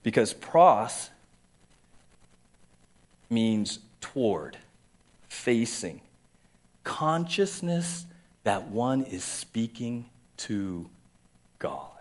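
slow, deliberate, each gloss set apart; slow definition. Shown as a demonstration. Pastor slowly defines pros for the congregation, reverent. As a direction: lay out the meaning word by word, slowly. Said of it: "defining the word slowly".

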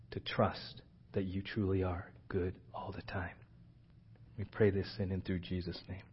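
a whispered plea at the prayer's end. Real, not acted. Pastor whispering the last petition of the prayer. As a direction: breathe the words as a whisper.

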